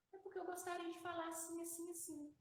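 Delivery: polite small child, lightly voiced; child's corrected tone. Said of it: "mudou a entonação da voz".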